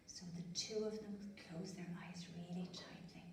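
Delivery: soft whisper, closing eyes tight. A narrator's reading. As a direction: hush it like a secret. Secret wishing moment.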